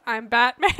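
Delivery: loud, gruff mock Batman growl; hammed-up Batman line. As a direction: growl it like the caped crusader. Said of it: "One of these is just".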